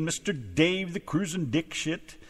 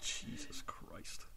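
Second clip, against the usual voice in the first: whisper; 11 semitones higher, 19 dB quieter, much slower than usual. Real, not acted. Host mutters after teasing.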